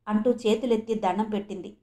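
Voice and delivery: plain reading voice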